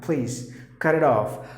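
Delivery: ordinary talk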